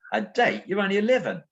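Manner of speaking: high, incredulous Cockney retort; Cockney accent. Retold or imitated. Old man questions the young boy, high astonished Cockney. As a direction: imitate a Cockney old man taken aback. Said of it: "lovely Cockney sort of accent".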